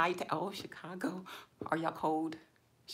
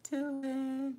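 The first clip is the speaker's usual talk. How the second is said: high, slow drawn-out hello; sing-song greeting; 8 semitones higher, 3 dB quieter than usual. Real, not acted. Streamer greeting her live chat in a high, slow sing-song.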